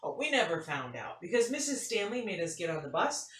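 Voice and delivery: lively storybook read-aloud; animated picture-book reading; reading to children. A narrator's reading. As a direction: tell it like story time for kids.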